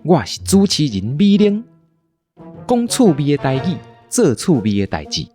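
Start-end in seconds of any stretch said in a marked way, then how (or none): none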